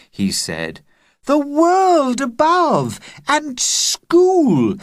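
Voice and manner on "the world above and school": put-on much higher, louder, slow girl's cry; excited young girl, high. A narrator's reading. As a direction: gush it out eagerly. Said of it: "cried Agnes".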